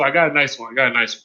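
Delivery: normal talking voice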